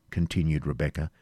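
plain reading voice

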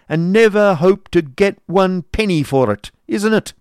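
loud, high sneering rant; mocking scold. A narrator's reading. Schoolmaster berating the unpaid boy, loud and mocking. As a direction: lay into the boy with scorn. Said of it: "replied Squeers testily".

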